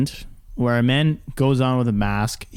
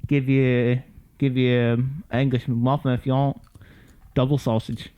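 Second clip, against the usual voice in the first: put-on British accent. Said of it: "You have to do it British".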